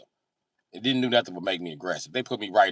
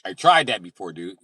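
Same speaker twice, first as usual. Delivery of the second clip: usual speaking voice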